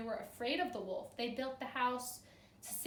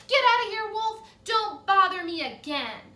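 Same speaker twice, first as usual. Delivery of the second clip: much louder, higher rude yell; rude shout; 9 semitones higher, 14 dB louder than usual. Acted. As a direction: bellow at the wolf to get lost. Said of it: "that rude little porker answered".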